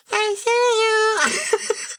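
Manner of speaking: very high, drawn-out mimicry; mock the eerie call, laughing; silly stretched falsetto imitation; goofy voice. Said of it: "It sounded almost goofy".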